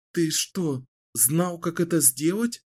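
surprised. Acted. Kahlan's surprised question.

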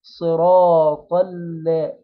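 صراط ال- recited slowly with a light, thin لام; slow recitation. Shown as a demonstration. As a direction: stretch out صراط ال- and keep the ل thin. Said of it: "مرقق اللي هو اللام".

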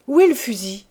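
loud, anxious burst; sudden anxiety. A narrator's reading. Old woman looking for her gun, suddenly anxious. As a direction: demand it in sudden worry. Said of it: "avec une inquiétude subite".

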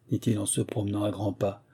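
plain reading voice

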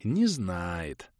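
quietly pleased remark; satisfied conclusion. A narrator's reading. Leader reading the girl's squeak, satisfied. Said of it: "с удовлетворением кивнул я".

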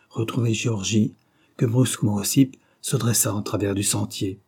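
ordinary delivery, nothing marked

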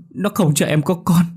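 wife insisting on the doll, high frantic cry; panicked repeat. A narrator's reading.

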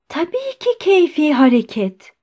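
high-pitched agreement with indignation; emboldened protest. A narrator's reading. Doctor encouraged by his friend's shouting, emboldened protest. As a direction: chime in, voice lifted in protest. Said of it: "bağırmasından cesaret bulan Andrei Yefimiç".

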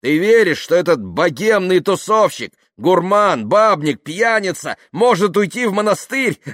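loud, high, incredulous tirade; ranting. Acted. Yuklin urging Dasha not to believe the party-goer, ranting. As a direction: shout with mounting scorn.

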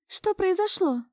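high-pitched woman's startled question; alarmed exclamation. A narrator's reading. Marusya walking in on him, exclaiming. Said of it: "услышал я восклицание Маруси".

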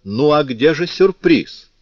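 high, loud, slow demanding question; decisive tone. A narrator's reading. Favourite demanding the promised surprise from Tholomyès, decisive tone. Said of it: "спросила решительным тоном".

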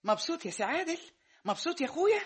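high, rising mocking "happy now?"; sarcastic taunt. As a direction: needle your son bitterly. Mother sarcastically taunts son.

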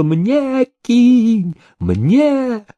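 very high, stretched scream-like cry; holy fool's shrill begging wail. A narrator's reading. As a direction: howl for a coin in a strained high pitch. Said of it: "он вопил".

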